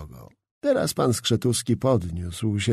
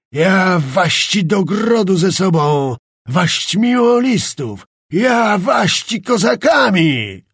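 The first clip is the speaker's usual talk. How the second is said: slow, high furious yell; shouting; 5 semitones higher, 5 dB louder, slower than usual. A narrator's reading. Czapliński threatening Skrzetuski with the court, furious shouting. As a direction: roar the threats across the room. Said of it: "Krzyczał tak".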